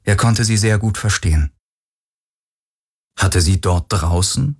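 ordinary delivery

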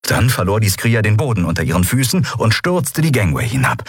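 ordinary delivery, nothing marked

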